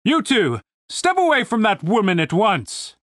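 commander booming orders from a wall. A narrator's reading. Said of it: "The booming voice sounded familiar".

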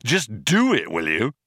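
fed-up, forceful command; shouting. A narrator's reading. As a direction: shout the order impatiently. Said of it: "he shouted".

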